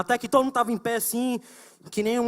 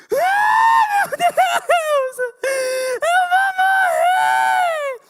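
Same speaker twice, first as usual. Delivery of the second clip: high, loud, shrieking panic in tears; panicked crying scream; 9 dB louder, much slower than usual. Retold or imitated. Girl panicking in the study room, screaming and sobbing. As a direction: scream like you're sure you'll die. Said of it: "chorando horrores".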